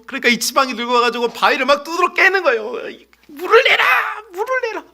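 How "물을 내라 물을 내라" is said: angry shout. Acted. Moses beats the rock angrily.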